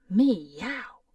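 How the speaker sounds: a loud rising cat meow. Acted. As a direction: yowl like a hungry cat. Cat-eyed doctor meows again.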